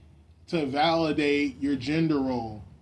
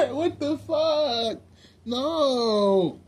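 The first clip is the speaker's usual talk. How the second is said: high, drawn-out wail through laughter; laughing mock wail; 9 semitones higher, much slower than usual. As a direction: stretch it out high, half laughing, half crying.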